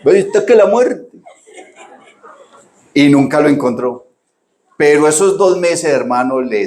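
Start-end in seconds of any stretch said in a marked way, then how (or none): 0.0-1.0 high laughing quote; laughing mock complaint. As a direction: laugh through the daughter's line. Laughing retelling daughter's line. (imitación de la hija entre risas)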